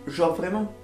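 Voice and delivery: ordinary talk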